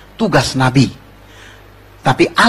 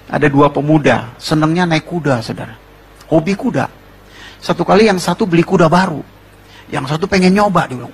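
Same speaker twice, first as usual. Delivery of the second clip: ordinary talk, nothing marked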